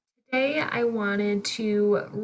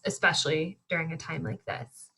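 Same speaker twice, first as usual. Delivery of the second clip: usual speaking voice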